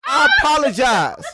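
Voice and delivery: high, loud, cracking with laughter; squealing tearful giggle; laugh-crying apology. Real, not acted. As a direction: beg forgiveness through hysterics. Laughing-crying apology after slip.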